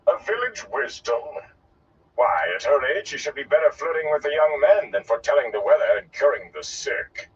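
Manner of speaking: high-pitched theatrical disbelief; scornful old performer. Acted. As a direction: play an incredulous, grandly mocking gleeman. Gleeman character's speech in narration.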